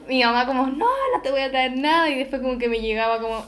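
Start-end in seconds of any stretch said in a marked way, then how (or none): 0.7-2.1 imitating mom's refusal; a touch of a cranky mother; mock annoyed mom. Mamá negándose, citada.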